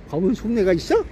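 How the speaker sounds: ordinary talk, nothing marked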